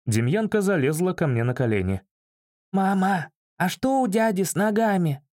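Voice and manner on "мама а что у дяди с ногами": high-pitched boy's question, only mock-whispered; little boy's stage whisper. A narrator's reading. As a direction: ask like a curious small boy, pitched up. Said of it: "Громким шёпотом осведомился мальчик".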